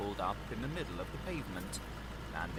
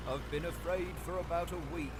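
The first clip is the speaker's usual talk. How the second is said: dogged; 8 semitones higher than usual. A narrator's reading. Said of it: "doggedly disregarding the question".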